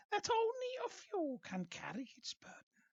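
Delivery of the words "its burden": breathy eerie whisper. Acted. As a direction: whisper the burden. Witch whispers the curse.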